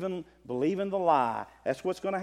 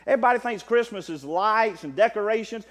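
loud preacher in full flow, climbing high; fiery preaching; 7 semitones higher, 10 dB louder than usual. Acted. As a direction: thunder it from the pulpit to the brethren. Preaching against cancel culture.

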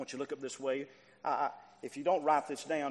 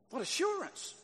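high exclamation; 14 semitones higher than usual. Real, not acted. Preaching climax echo.

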